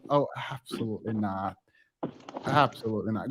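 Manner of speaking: low, quiet disbelief; appalled. Real, not acted. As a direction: shake your head at it. Shocked reaction to cousin's story.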